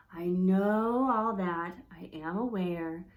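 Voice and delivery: loud, slow, fed-up I know; exasperated. Shown as a demonstration. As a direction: sound tired of being told. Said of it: "How would you tell your mom".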